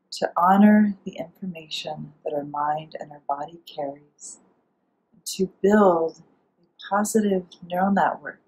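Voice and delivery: gentle, unhurried meditation guide with long pauses; soothing guided relaxation, spoken phrase by phrase. Acted. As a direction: ease the listener into calm, softening at phrase ends.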